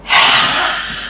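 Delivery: long open-mouthed breathy exhale; lion's breath exhale. Shown as a demonstration. Yoga teacher shows class the lion's breath, a forceful breathy exhale. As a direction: breathe out hard through the mouth like a lion. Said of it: "Last one".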